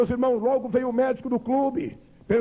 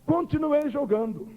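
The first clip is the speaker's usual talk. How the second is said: usual speaking voice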